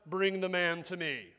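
lightly voiced king giving an order; a touch of kingly authority; royal command. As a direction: issue it as a mild regal decree. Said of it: "A king issues royal commands".

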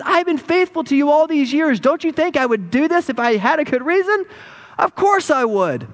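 high-pitched, reproachful pleading; aggrieved donkey. Acted. Faithful donkey defending itself to Balaam, aggrieved. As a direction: plead your case as the wronged, loyal animal.

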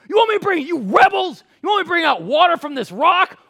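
very high, loud outburst; Moses, frustrated. Acted. Fed-up leader to the rebellious Israelites, frustrated shouting. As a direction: lose it at whining people, hammed-up shouting. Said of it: "Even Moses got frustrated with whining".

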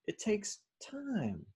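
slow, high and soft, "time" stretched; patient, lingering high-pitched repeat; drawn-out emphasis. Real, not acted. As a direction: stretch it out as if waiting.

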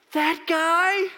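high, loud, incredulous yell; acted-out shock as it finally hits the boy; stunned realization. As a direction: cry out in disbelief.

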